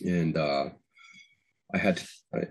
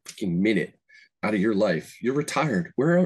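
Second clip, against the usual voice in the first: normal talking voice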